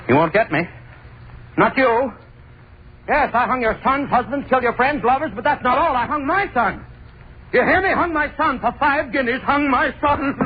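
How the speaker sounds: high, wild, unhinged confession; manic confession. Acted. Hangman confessing to the mob outside, manic. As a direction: scream your guilt at the door.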